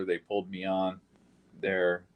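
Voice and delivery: ordinary talk, nothing marked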